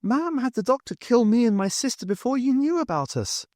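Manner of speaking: put-on a dead girl stating the truth; eerie calm child voice; calm ghost girl. A narrator's reading.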